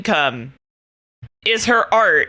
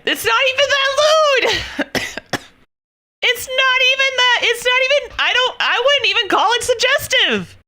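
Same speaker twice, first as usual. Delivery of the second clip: much higher, exasperated pleading; indignant protest; 12 semitones higher than usual. Real, not acted. Streamer to chat, defending the banned artist, high exasperated protest. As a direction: insist it was innocent, voice jumping high.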